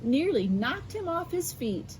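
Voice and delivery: bright storybook narration; reading to children. A narrator's reading. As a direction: read it like bedtime for kids. Reads picture book aloud.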